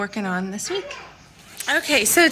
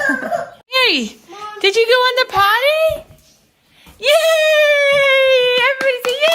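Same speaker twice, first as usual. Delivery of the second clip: potty training praise; 7 dB louder than usual. Real, not acted. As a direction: squeal with delight over a little win. Mom praising toddler on potty.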